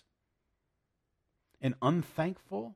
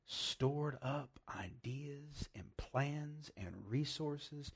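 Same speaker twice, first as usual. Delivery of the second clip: slow whisper; 10 dB quieter, much slower than usual. Real, not acted. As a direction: whisper it slowly, like a secret. Preacher drops to a whisper.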